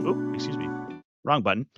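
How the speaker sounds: ordinary talk, nothing marked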